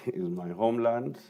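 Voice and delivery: ordinary delivery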